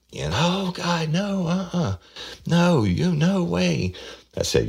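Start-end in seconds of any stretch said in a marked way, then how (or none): 0.4-3.9 very high falsetto mock denial; hammed-up squealing refusal, drawn out; imitating wailing suspect. Suspect denies killing her grandmother, mock high-pitched wail. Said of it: "she starts screaming".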